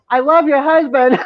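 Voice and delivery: high, teasing call to a wife; retold playful declaration. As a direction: re-enact calling it out for fun. Re-enacts calling to the wife.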